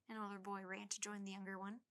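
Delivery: ordinary delivery, nothing marked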